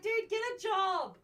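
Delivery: high, slow scornful jab; mocking scorn. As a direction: sneer it at the scammer. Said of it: "I'm like".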